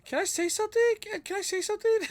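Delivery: put-on very high, squeaky little-boy voice; Plant Boy impression. Streamer doing the Plant Boy voice chat requested. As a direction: pipe it up like a tiny kid. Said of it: "We need your Plant Boy impression".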